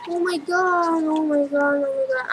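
low, soft nervous chant; freaking out. Real, not acted. Player freaking out mid-game, nervous low murmuring. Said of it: "I'm freaking out".

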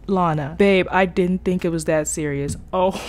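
ordinary talk, nothing marked

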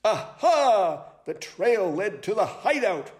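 high, lively sleuth voice; excited high-pitched owl sleuth; eager owl detective. Acted. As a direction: announce it like a keen detective.

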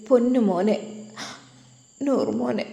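a mother missing her little son, sobbing lightly; quiet sobbing. Acted. Mother remembering her baby son, softly whimpering. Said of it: "അവൾ വിതുമ്പുന്നത്".

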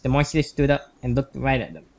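normal talking voice

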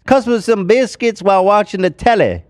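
high, hammed-up British accent; English accent. Shown as a demonstration. Comic proving accents are harmless, hammed-up English accent. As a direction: ham up a cheeky English accent. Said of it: "or an English accent?".

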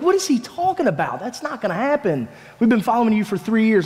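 high-pitched, disbelieving objection; incredulous protest. Acted. Disciples react to being told they will fall away, incredulous. Disciples' stunned reply to Jesus's prediction.

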